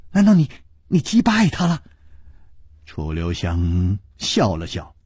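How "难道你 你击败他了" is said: eager question. A narrator's reading. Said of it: "琵琶公主已经抢着说".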